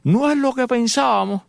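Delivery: the neighbour calling to Eulalia inside; mock shout. (vecino gritando a su esposa, lento y agudo)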